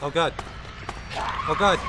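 real fear, yelling out loud; terrified shout. Real, not acted. Terrified by a chasing monster.

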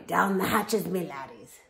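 loud pirate call, corrected; pirate yell. Acted. Captain yelling at his crew, loud pirate call. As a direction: bellow it again, fixing the word. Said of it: "pounded his fist on the table and yelled".

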